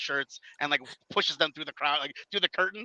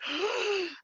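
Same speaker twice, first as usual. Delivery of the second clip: a sudden high gasp; sharp gasp; 5 dB quieter than usual. Retold or imitated. Retelling the suplex with a gasp. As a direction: gasp as if grabbed.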